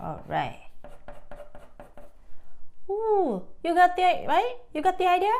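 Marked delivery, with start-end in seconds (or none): 2.9-5.3 high, eager and louder; bubbly "you got the idea?"; excited anime fan. Real, not acted. Excited check with viewers.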